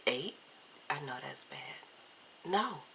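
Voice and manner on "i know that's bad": sheepish whisper. Real, not acted. Confessing adult Halloween costumes.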